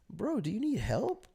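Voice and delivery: high, soft worried question; genuinely concerned. Retold or imitated. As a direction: imitate earnest concern for a friend. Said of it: "I'm genuinely concerned".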